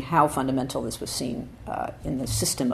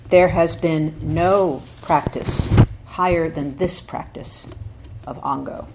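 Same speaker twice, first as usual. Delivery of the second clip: slow, louder, weighty recitation of the text; solemn scripture reading; 4 dB louder, slower than usual. Acted. Teacher reading Dogen to the sangha, solemn and slow. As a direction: read it like sacred text, measured and slow.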